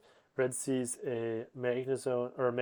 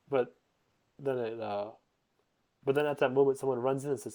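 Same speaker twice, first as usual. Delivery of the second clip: ordinary talk, nothing marked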